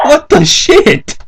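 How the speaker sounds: high, slow stunned what the shit; baffled. Real, not acted. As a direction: stretch it out in disbelief. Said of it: "reacting to a garbled word".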